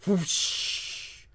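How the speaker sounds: soft unvoiced whoosh effect; whispered whoosh. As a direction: breathe out a whoosh. Voiced whoosh.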